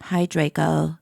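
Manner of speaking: low, downcast mock greeting; depressed voice. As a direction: say hello as a mopey goth. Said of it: "I said in depressed voice".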